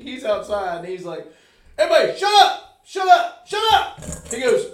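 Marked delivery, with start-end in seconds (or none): 1.8-4.0 imitated shout. Retelling the friend yelling for the bar to shut up, loud mock yell. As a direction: bellow it like a rowdy host silencing the crowd.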